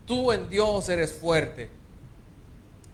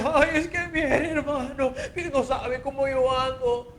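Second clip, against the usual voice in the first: high, slow, put-on whine; whiny mock complaint; 7 semitones higher than usual. Preacher imitating a complaining believer, high whiny mockery. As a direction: mimic a self-pitying church member.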